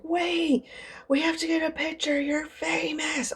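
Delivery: high-pitched imitated urgency; hammed-up starstruck plea; excited fan. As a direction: mimic an excited fan calling you back, voice way up. Imitates the fan's excited husband.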